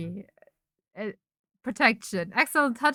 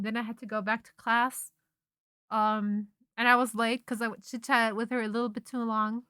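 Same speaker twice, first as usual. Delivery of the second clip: ordinary talk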